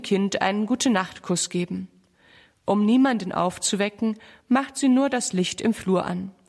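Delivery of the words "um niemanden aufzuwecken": usual speaking voice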